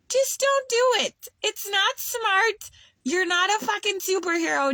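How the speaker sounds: high, pressing warning to viewers; emphatic warning. Real, not acted. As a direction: urge them hard not to do it. Warning viewers against a mistake.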